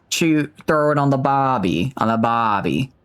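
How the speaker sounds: practising an Aussie catchphrase; amateur Australian twang; Australian accent. Shown as a demonstration. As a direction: try out a rough Australian accent.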